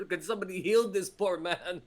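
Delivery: loud, high-pitched comic exasperation; exasperated plea. Acted. Player begs the party to heal the poor man, exasperated. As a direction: plead loudly, at wits' end. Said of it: "complaining about this, a little exasperated".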